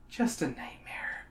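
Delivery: sleepy, half-whispered mumble; groggy mumble. A narrator's reading. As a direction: murmur it barely awake. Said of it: "you mumble groggily".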